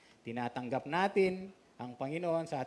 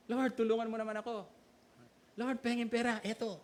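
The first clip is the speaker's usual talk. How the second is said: high, quick pleading voice playing a needy believer; playful imitation of a begging prayer; mock prayer; 5 semitones higher, faster than usual. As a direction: beg God like a cheeky churchgoer. Mock prayer asking God for money.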